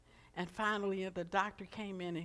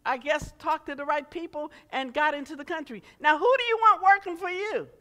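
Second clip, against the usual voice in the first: loud, rousing sermon voice, higher than the story; preaching; 10 semitones higher, 14 dB louder than usual. Acted. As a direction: preach it at full force to the room. Preaching to the congregation.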